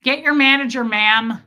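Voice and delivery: loud demanding customer; entitled customer. Retold or imitated. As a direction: bark for the manager loudly. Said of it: "He sounds like a horse".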